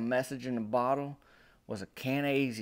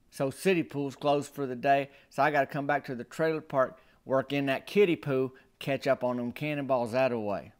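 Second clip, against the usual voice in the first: ordinary delivery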